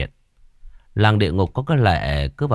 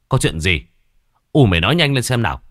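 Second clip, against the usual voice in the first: fast, louder urgent questions; pressing urgently; 4 semitones higher, 5 dB louder, faster than usual. A narrator's reading. Husband presses his crying wife for news, fast and urgent. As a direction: demand an answer right now, quickly. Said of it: "hỏi dồn".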